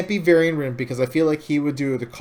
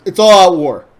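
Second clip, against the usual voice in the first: loud, raised, angry rallying shout; furious war cry; 5 semitones higher, 9 dB louder, faster than usual. Retold or imitated. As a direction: bellow it like a king declaring war. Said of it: "Varian saying".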